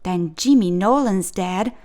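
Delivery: ordinary delivery, nothing marked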